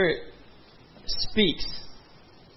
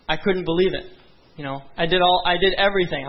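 ordinary talk

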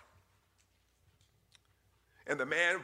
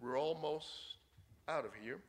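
soft, hushed and steady; father murmuring low to his screaming son; 7 dB quieter than usual. Retold or imitated. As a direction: keep it low and calm, just for the child. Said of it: "In a very low voice".

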